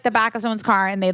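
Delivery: ordinary delivery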